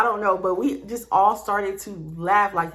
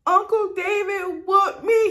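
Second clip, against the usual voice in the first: mock tearful wail, high and slow; retold crying; 11 semitones higher, slower than usual. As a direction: wail it out slowly, imitating your crying self. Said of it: "I just started crying".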